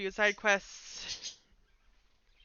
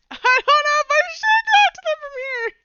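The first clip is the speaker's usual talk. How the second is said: squeaky shriek, much higher and louder; high squeal; 11 dB louder than usual. Acted. Squealing player character asking how to talk to frogs, shrill squeak. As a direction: squeal it out in panic.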